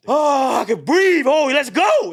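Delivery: high, loud burst of elation; exhilarated. Acted. Runner finds new lungs, exhilarated shout. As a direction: cry out with sudden new energy.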